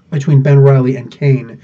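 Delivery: ordinary talk, nothing marked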